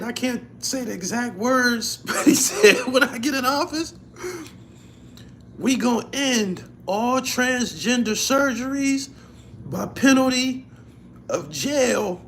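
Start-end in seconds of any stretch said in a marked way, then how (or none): 2.9-12.2 mock Trump proclamation, loud and forceful; booming declaration, laughing at the end; loud retelling.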